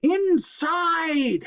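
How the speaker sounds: high urgent command; urgent hiss. Acted. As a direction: urge them in fast. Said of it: "hissed Huggleduck".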